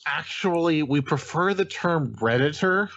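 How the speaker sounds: slow, high know-it-all nerd. Shown as a demonstration. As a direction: correct them like a pedantic Redditor. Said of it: "a nerd voice of some kind".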